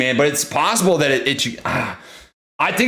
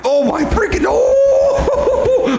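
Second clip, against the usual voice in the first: excited yelp; much slower than usual. Real, not acted. Streamer watching the stock break a new high, ecstatic. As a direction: let it burst out of you.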